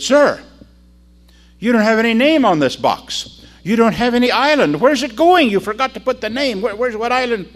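high, loud, flustered questioning; alarmed protest. Acted. Sailor to the sender about an unaddressed box, flustered. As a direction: call out in acted bewilderment, stumbling at the end.

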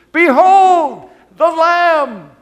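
high, slow ringing shout; slow triumphant shout; proclamation. Acted. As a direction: cry "Behold the Lamb!" out to the crowd. Preacher proclaims John's words.

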